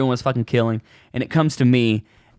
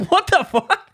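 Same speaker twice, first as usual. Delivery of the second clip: stunned disbelief; slower than usual. Retold or imitated. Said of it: "And I'm like".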